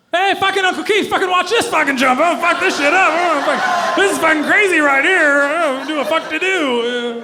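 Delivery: put-on high, loud, whooping kid voices; rowdy kids shouting and laughing; excited kids yelling. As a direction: yell it like wild eleven-year-olds showing off a jump. Kids cursing excitedly at uncle.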